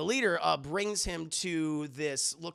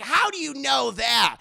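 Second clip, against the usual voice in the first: mock shrill question; 8 semitones higher, 10 dB louder than usual. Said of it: "she's like".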